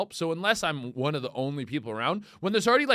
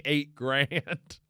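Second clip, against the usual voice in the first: ordinary talk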